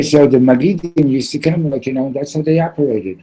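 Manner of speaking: plain reading voice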